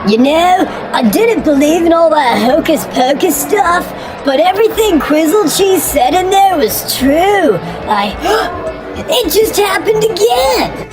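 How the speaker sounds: high, fast amazed voice; amazed testimonial. Acted. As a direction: gush about the psychic, awestruck.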